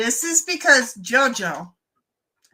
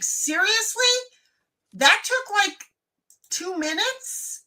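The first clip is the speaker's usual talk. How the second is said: amazed guest; 8 semitones higher, slower than usual. Retold or imitated. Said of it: "you're gonna be like".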